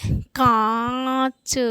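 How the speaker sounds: कांच with very long nasal आ, separate च; nasalised vowel demo. Shown as a demonstration. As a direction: stretch कांच through mouth and nose. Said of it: "तो ये उच्चारित होगा".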